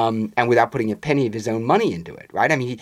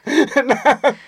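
short real laugh, high; 5 dB louder than usual. Real, not acted. After telling Alex's betrayal line, high real laugh. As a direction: burst out laughing.

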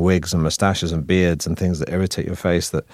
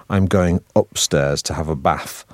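slow, broad Manchester accent; northern English accent; slower than usual. Shown as a demonstration. Actor showing his original regional speech, northern Manchester accent. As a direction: say upstairs and bath with short northern vowels. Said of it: "how I would have said it in my original accent".

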